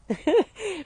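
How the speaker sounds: a short high laugh through tears; teary laugh. Real, not acted. Coach tearing up on a call, laughs it off. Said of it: "I'm starting to tear up now".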